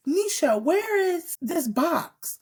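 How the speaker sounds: put-on high, drawn-out childish asking; stepdaughter voice. Stepdaughter asking Nisha about the box, high childish voice. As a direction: play the kid hunting for her box. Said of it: "She was like".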